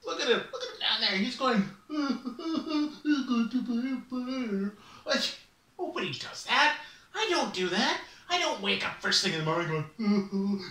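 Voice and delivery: laughing raven voice. Acted. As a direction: scoff at the silly bear from above.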